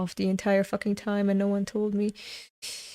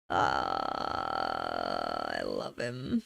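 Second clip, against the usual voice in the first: much lower and softer, sighing fondness; swooning; 9 dB quieter, much slower than usual. Real, not acted. Reader gushing softly over a favourite character.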